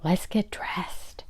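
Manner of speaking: slow, hushed whisper. Acted. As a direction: whisper it secretly to your sister. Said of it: "Anne whispered".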